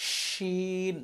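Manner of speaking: শিন with a long diffuse sh, stretched vowel; spreading sh. Shown as a demonstration. Teacher showing the sound must spread, long sh. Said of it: "আওয়াজ ছড়াবে".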